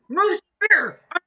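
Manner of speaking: high, loud, fed-up shout at the waiter; exasperated angry yell. Retold or imitated. Rock star yelling at a clueless waiter, loud and exasperated. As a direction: blow up, half laughing, half furious. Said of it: "And [name] goes".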